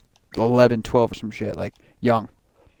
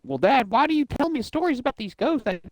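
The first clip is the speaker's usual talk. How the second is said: high, put-on naive kid voice; mock innocent son; 11 semitones higher than usual. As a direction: play the puzzled son asking his dad. Retold talk with game dad.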